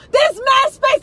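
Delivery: frustrated yell. Acted. Arguing with driver, yelling.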